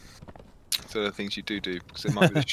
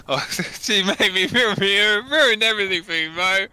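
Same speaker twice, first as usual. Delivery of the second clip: laughing complaint; 9 semitones higher, 6 dB louder than usual. Real, not acted.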